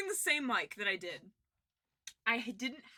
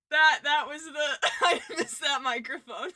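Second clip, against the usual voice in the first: talking through laughter, loud; real laughter; 4 semitones higher, 10 dB louder than usual. Real, not acted. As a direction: laugh your way through the words. Laughing after watching old clip.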